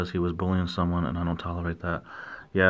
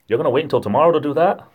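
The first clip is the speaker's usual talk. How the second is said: higher, louder, quick incredulous question; questioner in accent demo; 8 semitones higher, 5 dB louder, faster than usual. Acted. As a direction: ask it fast and high, astonished. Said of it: "That's the New Hampshire accent".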